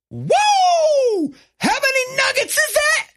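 high, loud astonished yell from the back; mock kitchen worker gasping at a huge order; surprised shout. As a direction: shout it like you can't believe the pile.

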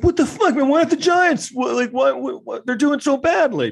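very high, strained whine of a sore fan; high-pitched mock-whiny sports fan; whiny fan parody. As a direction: squeal it like a spoiled fan. Imitating himself whining over Giants.

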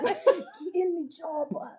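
whispering impression. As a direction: say it softly in the put-on hero voice. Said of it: "Why the fuck are you whispering it?".

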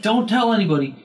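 high, loud mock yell; imitated yelling. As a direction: mimic a deaf man hollering his secret. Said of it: "and he's yelling this".

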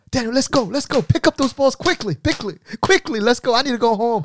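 high, hurried orders; impatient coach. Retold or imitated. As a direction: hurry the kid along impatiently. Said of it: "He would always go".

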